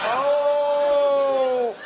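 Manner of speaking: long, high, loud fake moan of pleasure; loud mock moan. Shown as a demonstration. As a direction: let out an over-the-top moan. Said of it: "do it as loud as you can".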